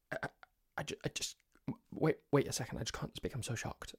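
breathless stunned whisper; shocked whisper. Retold or imitated. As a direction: whisper it, too shocked to speak. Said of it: "shocked into silence".

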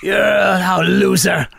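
slow, high, shouted fake Shakespeare curses; cursing old actor. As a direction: bellow made-up curses like King Lear. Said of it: "He just calls him".

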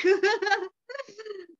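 a high, loud burst of laughter. Real, not acted. Telling the podcast host about the breakdown, a loud high laugh. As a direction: laugh it off, half laughing half crying.

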